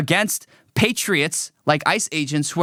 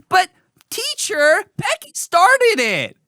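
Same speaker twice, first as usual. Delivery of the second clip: put-on much higher, slow tattling child voice; whiny little kid; 14 semitones higher, slower than usual. Child tattling to the teacher, high whiny kid voice. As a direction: whine it up to the teacher like a toddler. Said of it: "mediating a fight between two young kids".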